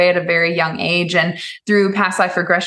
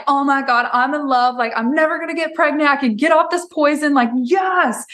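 higher-pitched imitation of a giddy outburst; mock-swooning, pitched up; retold gushing; 6 semitones higher than usual. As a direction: gush like your smitten younger self. Retold gushing younger self in love.

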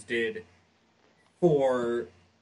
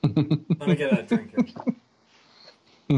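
laughing; 3 semitones higher, 8 dB louder than usual. Real, not acted. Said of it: "I got a frog in my throat".

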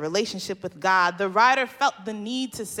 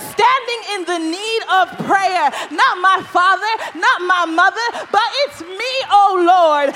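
loud, higher pleading, some lines shouted; impassioned preaching prayer; 8 semitones higher, 7 dB louder than usual. Acted. Preacher voicing Jabez begging God, loud pleading prayer. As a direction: beg God not to forget you.

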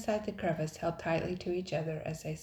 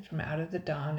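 usual speaking voice

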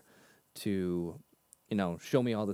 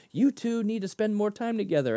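raised, declaring prophecy voice, lightly imitated; mock prophetic pronouncement; 9 semitones higher, 4 dB louder than usual. Prophet telling two men to meet, raised and declaring. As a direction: announce it like a prophecy. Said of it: "Dan Lee who comes down and prophesies".

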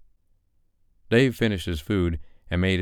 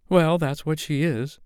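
much higher, dismissive diva; haughty dame; 11 semitones higher than usual. A narrator's reading. Madam M defends her insult, higher haughty dismissal. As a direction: shrug it off haughtily.

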